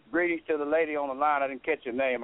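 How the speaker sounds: ordinary delivery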